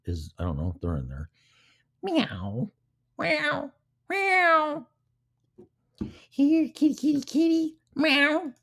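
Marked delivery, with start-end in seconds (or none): 2.0-4.9 high-pitched imitated meows; cat meowing. 6.3-8.5 very high falsetto cat call; kitty calling. Pretend. Playfully calling a cat, meowing.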